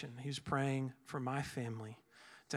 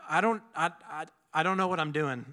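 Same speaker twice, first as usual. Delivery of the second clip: flustered; 2 semitones higher, 5 dB louder, faster than usual. Retold or imitated. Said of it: "I was like".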